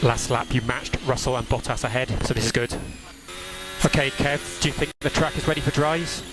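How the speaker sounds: normal talking voice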